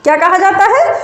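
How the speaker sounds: ordinary talk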